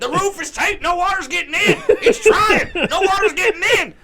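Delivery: high, frantic announcer shouting. Acted. Comedian calling a tape commercial, hammed-up frantic commentary. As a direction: yell it like a wrestling climax. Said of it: "us doing J R impressions".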